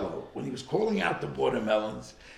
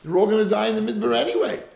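mocking Pharaoh; 6 semitones higher, 4 dB louder than usual. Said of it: "So he said".

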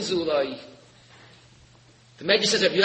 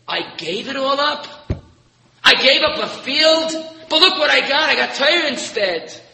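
incredulous rebuttal, raised and emphatic; retold scorn; 5 semitones higher, 4 dB louder than usual. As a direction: throw the question back scornfully, then insist loudly. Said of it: "he would scorn you and he would say".

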